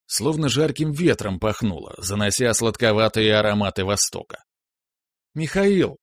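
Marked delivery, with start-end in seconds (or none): 5.3-6.0 put-on high, ringing girlish cry; ringing call. A narrator's reading. Natasha bursting in on Mikhail, high ringing call. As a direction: ring the name out high and quicker. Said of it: "прозвенела девушка".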